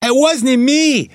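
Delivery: high mocking falsetto of a lying suspect; mocking whine. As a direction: parrot his denial back in a silly high voice. Detective mocking suspect's denial.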